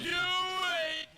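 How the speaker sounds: high do it; yelling at the screen. Real, not acted. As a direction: cheer at the footage. Yelling at replay.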